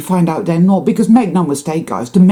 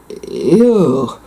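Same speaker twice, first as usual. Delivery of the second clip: ordinary talk, nothing marked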